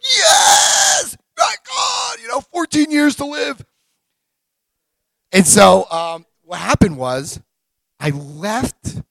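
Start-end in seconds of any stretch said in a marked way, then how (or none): 0.0-3.6 high, loud whooping cheer; ecstatic shout. Retold or imitated. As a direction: shout it like you won the lottery. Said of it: "I'm like, inside, I'm having the biggest party".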